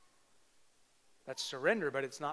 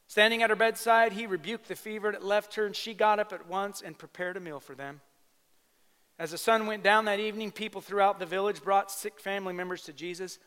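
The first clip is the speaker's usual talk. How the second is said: loud, high preaching read; 6 semitones higher, 9 dB louder than usual. Acted. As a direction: proclaim the scripture to the room. Preaching the healing passage.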